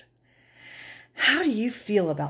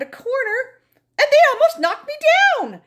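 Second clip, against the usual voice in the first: much higher and louder in shock; startled alarm; 8 dB louder, faster than usual. Acted. Narrator nearly knocked down by pants, startled cry. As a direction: jolt of fright as they rush at you.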